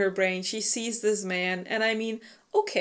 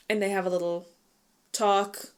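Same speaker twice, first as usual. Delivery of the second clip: ordinary delivery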